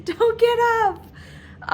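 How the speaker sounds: high, slow, frantic pleading; a panicked aunt's high, pleading voice. Retold or imitated. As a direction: imitate Aunt Jen begging her to stay down in a panic. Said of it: "And Aunt Jen was freaking out".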